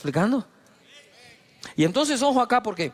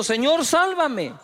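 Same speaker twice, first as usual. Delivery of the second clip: cry for help; 8 semitones higher, slower than usual. Acted. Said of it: "créame que grita".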